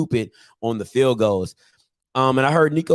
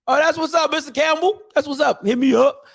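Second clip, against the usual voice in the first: excited shout-out; 12 semitones higher than usual. Real, not acted. Greeting a viewer.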